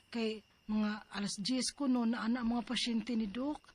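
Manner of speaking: ordinary talk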